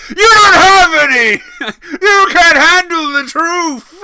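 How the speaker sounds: very high, loud, slow screamed quote; Nicholson impression. [name] shouting as Jack Nicholson, high and loud. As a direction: rant like Jack Nicholson on the stand. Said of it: "boots in as a Nicholson".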